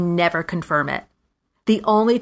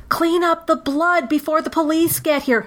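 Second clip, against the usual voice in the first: high mock-urgent aside to someone behind; fake crime aside; 9 semitones higher than usual. To put off the caller, a hammed-up urgent aside. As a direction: pretend there's a body to clean up. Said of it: "something crazy like that to kind of put off the person".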